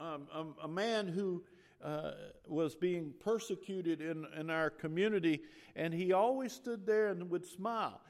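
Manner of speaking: ordinary delivery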